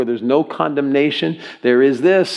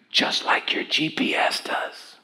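hushed, drawn-out whisper; slow stage whisper; 3 semitones higher, 7 dB quieter, slower than usual. Shown as a demonstration. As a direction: whisper it slowly, almost soundless. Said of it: "a little bit louder than the voice".

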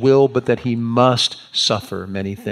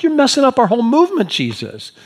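high, louder, agitated protest; upset disciple objecting, pitched way up; heated Peter; 11 semitones higher, 4 dB louder than usual. Acted. Peter upset at Jesus' kind of talk, heated protest. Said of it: "Peter is heated".

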